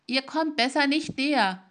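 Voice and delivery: laut und höher warnend rufen; raue, laute Warnung an den Fremden; rauer Ruf. A narrator's reading. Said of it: "rief der Traviergeweihte mit rauer Stimme". (Geweihter warnt den Fremden, rauer lauter Ruf)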